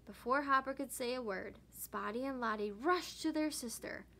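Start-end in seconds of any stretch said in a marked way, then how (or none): none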